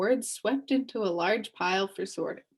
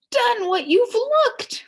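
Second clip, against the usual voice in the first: high, slow, furious shout; angry shout; 8 dB louder, slower than usual. Acted. Salesman shouting angrily at the crowd, furious. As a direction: bellow the jumbled words in outrage. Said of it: "angrily shouted one of the salesmen".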